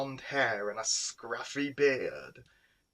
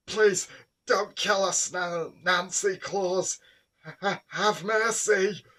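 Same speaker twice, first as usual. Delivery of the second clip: high, loud, stammering plea for mercy; terrified spluttering; 8 semitones higher, 7 dB louder than usual. A narrator's reading. Boy begging the vampire not to kill them, terrified. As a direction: beg for your life, tripping on the words. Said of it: "Dylan spluttered in a terrified voice".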